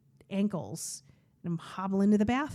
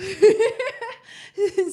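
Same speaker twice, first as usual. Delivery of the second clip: a high, loud burst of real laughter; 12 semitones higher, 8 dB louder than usual. Real, not acted. Real laugh after playfully greeting her new hobby. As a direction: laugh out loud at her own flirty joke.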